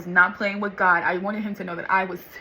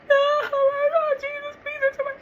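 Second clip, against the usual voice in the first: crying plea. Retold or imitated. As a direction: beg Jesus through tears. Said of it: "I am crying".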